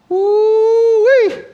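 high, loud "woo-wee" whoop; excited whoop. Real, not acted. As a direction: let out a delighted holler. Preacher exclaims at God's reply.

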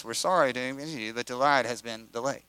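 flat, fast, soft recorded announcement; automated voice. Retold or imitated. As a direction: rattle it off like a looping recording. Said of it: "that same automated voice".

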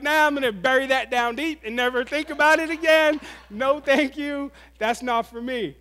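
high, loud comic brush-off; hammed-up avoidance, shutting the topic down; emphatic refusal. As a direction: override it loudly, slowing for the last refusals. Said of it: "an ignore and override".

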